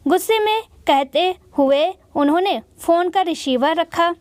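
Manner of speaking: ordinary delivery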